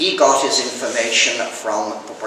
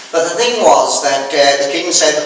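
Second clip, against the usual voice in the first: ordinary talk, nothing marked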